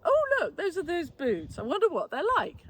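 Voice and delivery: excited thought. Retold or imitated. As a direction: act out spotting the boots with glee. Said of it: "and I thought".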